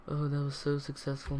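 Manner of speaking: usual speaking voice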